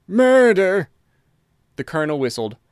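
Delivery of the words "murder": shocked cry. A narrator's reading. Alarmed butler announcing the murder.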